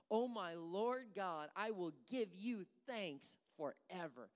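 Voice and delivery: high, loud, slow proclamation of joy; preacher voicing David's joyful thanks; exultant praise. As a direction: lift the words up in triumph.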